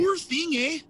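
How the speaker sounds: high, loud Canadian eh; joking Canadian accent; mock Canadian. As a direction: play an overly polite Canuck. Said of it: "give her a Canadian accent".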